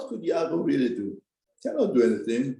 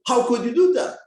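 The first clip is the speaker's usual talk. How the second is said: higher, louder, indignant demand; indignant retort; 8 semitones higher, 7 dB louder than usual. Acted. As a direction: hurl the question at her.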